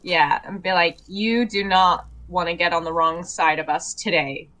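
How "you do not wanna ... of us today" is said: low, measured warning; lightly voiced threat, pitched down; threatening. Standing over the new med tech, low threat. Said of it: "threateningly kind of impose over this new med tech".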